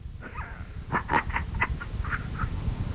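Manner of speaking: long, breathy, soft chuckle; character's laugh. A narrator's reading. Hong Qigong facing Ouyang Feng, weak breathy laugh. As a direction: laugh softly in separate little puffs. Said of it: "洪七公睁眼笑道".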